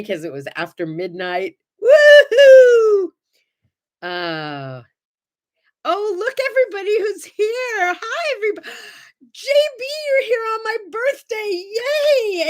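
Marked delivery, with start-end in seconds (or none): 1.8-3.1 loud, high birthday whoop; shrieked birthday woohoo; joyful whoop. Real, not acted. Cheering her own birthday. 5.8-12.5 high, loud, delighted hellos to viewers; thrilled welcome to live viewers; excited greeting. Real, not acted.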